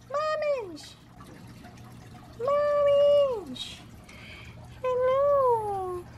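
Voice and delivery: very high squeaky doll voice; falsetto doll voice. Acted. Voicing her doll in a very high squeak.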